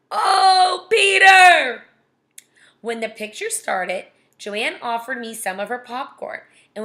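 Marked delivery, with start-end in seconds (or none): 0.1-1.8 high, loud drawn-out shout; teasing outburst. Acted. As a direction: holler the name in mock scandal. Reader teases Peter about his crush.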